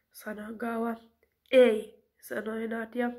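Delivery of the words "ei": firm loud no. Acted. Nadja refuses flatly.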